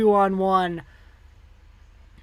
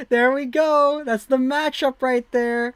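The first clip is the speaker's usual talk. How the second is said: excited; 7 semitones higher, 4 dB louder than usual. Real, not acted. Excited about the faceoff matchup.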